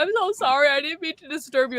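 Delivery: high-pitched, pleading pet apology; cooing, high apology that eases down; apologizing to dog. Retold or imitated. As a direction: retell a sorry to your dog, starting way up high. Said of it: "a whole apology with the dog where I was like".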